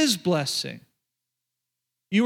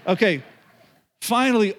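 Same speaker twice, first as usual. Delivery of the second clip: usual speaking voice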